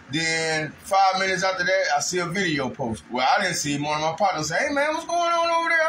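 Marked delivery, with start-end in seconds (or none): 4.6-5.9 imitating his partner. Partner calling about video.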